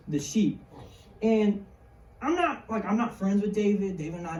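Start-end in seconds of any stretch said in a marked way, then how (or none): none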